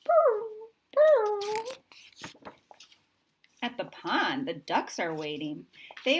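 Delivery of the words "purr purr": very high, loud purr; pigeon coo. A narrator's reading. Pigeons asking to be fed, high cooing. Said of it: "They say".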